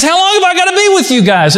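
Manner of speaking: fed up with the disciples, rush it out; exasperated Jesus. Retold or imitated. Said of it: "he gets up and he says".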